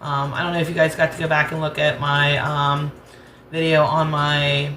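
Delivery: normal talking voice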